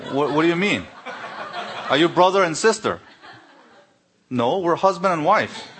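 incredulous, laughing through the words; laughing disbelief. Retold or imitated. Replying to the airport clerk, laughing in disbelief. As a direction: laugh as you protest the mix-up.

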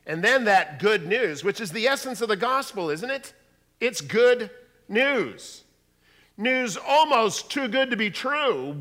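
loud, high, emphatic sermon delivery; preaching at full force. Real, not acted. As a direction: proclaim it to the whole congregation.

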